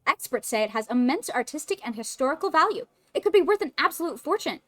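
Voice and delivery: eager game character. Acted. Game character showing a special issue, high and eager. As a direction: lift the pitch and show off the news.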